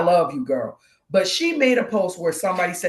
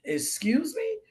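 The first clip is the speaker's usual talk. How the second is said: high, softer startled query; taken-aback question; 8 semitones higher, 6 dB quieter, slower than usual. Retold or imitated. Lady stunned by the hotel confession, taken aback. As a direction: imitate someone stopped short in surprise. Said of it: "she stopped and she said".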